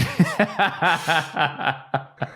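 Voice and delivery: long peal of laughter; helpless, drawn-out laugh; real laughter. Real, not acted. Host cracking up over a song choice, real laughter.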